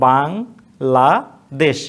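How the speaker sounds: Bangladesh said very slowly, syllable by syllable; slow classroom demo; drawn out syllables. Shown as a demonstration. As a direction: stretch Bangladesh out to show each stop. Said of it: "Harsh চিহ্ন".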